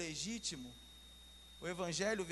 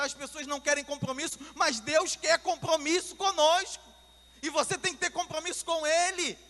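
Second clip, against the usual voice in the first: loud, high, impassioned sermon; full-force preaching; 8 semitones higher, 11 dB louder than usual. Real, not acted. Preacher urging God's commitment on the congregation, at full force. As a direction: build hard, hammering each phrase home.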